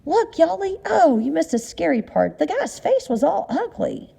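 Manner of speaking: high, excited mother's cry; excited scream. Acted. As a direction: squeal it out at the scary movie. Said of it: "her mother screamed".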